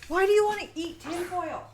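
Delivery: high, loud, exasperated question; exasperated scolding. Real, not acted. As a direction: raise your voice at a maddening cat. Scolding cat eating tinfoil.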